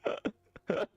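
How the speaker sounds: words breaking into high-pitched laughter; laughing. Real, not acted. Streamer reads a viewer's absurd combined request, cracking up. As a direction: crack up while reading it out.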